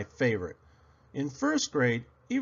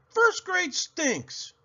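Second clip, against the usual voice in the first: much higher, slow, drawn-out whine; sulky kid verdict; much slower than usual. A narrator's reading. Girl declaring her new grade awful, slow high whine. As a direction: stretch it out like a pouting child.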